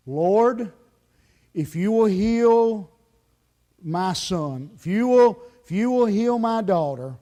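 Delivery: loud, drawn-out plea to God; disaster praying. Acted. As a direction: imitate a desperate, bargaining prayer. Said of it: "our disaster praying sound something like this".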